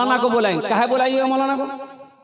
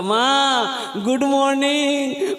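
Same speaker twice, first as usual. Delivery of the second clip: put-on child's greeting; 3 semitones higher, much slower than usual. Child greets mother in English.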